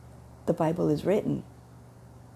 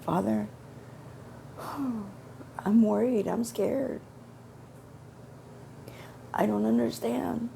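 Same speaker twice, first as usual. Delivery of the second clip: higher, teary plea with a shaky sigh; crying out to Father, scared and confused; tearful prayer; 7 semitones higher than usual. Retold or imitated. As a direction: pour out your worry to God near tears. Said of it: "And I'm starting to cry".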